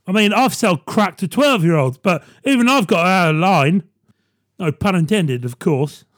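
normal talking voice

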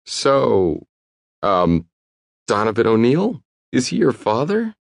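hesitant, rising high into the question; a shy waiter working up his nerve; nervous. Acted. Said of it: "obviously nervous to ask".

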